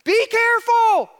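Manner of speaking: forceful high cry of caution; emphatic shout. Retold or imitated. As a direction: shout a warning at full pitch. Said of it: "That's kinda what he's doing".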